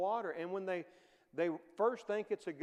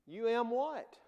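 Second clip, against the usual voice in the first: mock baffled retort, a bit louder; mock puzzled; 5 semitones higher, 4 dB louder, slower than usual. Preacher mocking a listener's confusion, puzzled retort. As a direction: puzzle over the phrase, half-joking.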